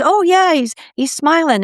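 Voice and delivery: beam as you pass on the good news; delighted report. Retold or imitated. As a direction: medium cheerfully relaying the sign. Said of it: "She says".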